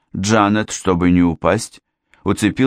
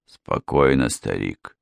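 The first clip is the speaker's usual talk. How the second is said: lower, softer settling voice; calming a friend; 7 semitones lower, 4 dB quieter, slower than usual. A narrator's reading. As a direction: soothe him, dropping very low. Friend calms the angry father. (успокаивающе, тихо и низко)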